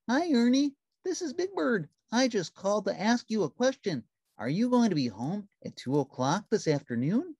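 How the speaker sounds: gentle, innocent giant bird; Big Bird voice. A narrator's reading. Narrator voicing Big Bird calling Ernie on the phone, high and sweet.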